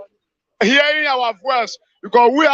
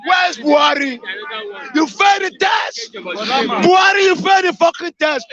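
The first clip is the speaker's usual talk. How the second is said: furious shout; 2 semitones higher than usual. Real, not acted. Angry caller shouting at the absent president. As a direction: hurl the blame straight at the president.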